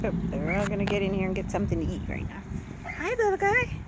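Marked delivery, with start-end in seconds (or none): 3.0-3.8 puppy talk. Real, not acted. Greeting puppy.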